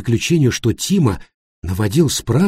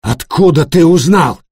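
loud, high enraged demand; 6 semitones higher, 6 dB louder than usual. A narrator's reading. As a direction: demand it in a rage. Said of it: "в припадке ярости".

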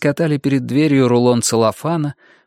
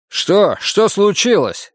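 high, urgent questioning; alarmed question; 8 semitones higher, slower than usual. A narrator's reading. Roger asking his partner after hearing him yell, alarmed. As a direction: pitch leaping up in alarm.

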